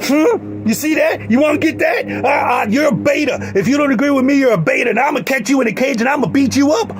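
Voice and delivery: high-pitched, worked-up threatening rant; comic bully outburst; mock threat.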